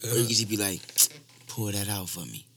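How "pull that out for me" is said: soft, low, discreet murmur; mock whisper. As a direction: ask quietly, as if whispering to her. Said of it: "you gotta, like, whisper to her".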